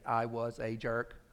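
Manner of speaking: slow, word-by-word confession; slowly spaced, each word weighted; deliberate admission. Shown as a demonstration. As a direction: spell it out one word at a time. Models an admission to learn.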